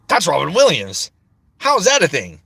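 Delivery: excited, high and loud disbelief; a kid blurting out his shock at the TV; amazed. Retold or imitated. Kid recognizing Robin Williams, amazed and loud.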